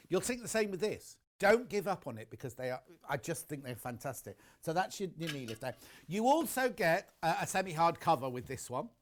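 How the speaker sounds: ordinary talk, nothing marked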